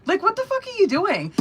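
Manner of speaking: high, exasperated voicing of him, climbing on the second question; mock incredulous man. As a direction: mimic him asking what she's doing, pitch shooting up. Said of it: "Like, he's over here".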